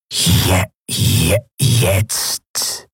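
slow, breathless halting whisper that stumbles; a ruined machine groping for its lost task; whispering broken machine mask. Acted. Maske stottert. (stockend flüsternde Maske, verwirrt)

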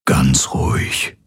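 slow, soothing whisper; calm a man in agony with a whisper. A narrator's reading. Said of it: "flüsterte er".

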